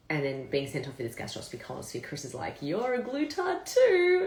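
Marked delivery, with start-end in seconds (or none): 2.6-4.2 high, loud, drawn-out taunt; mock teasing. Chris teases speaker about coeliac disease, high drawn-out mock taunt. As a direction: imitate a gleeful tease, stretching it out slowly.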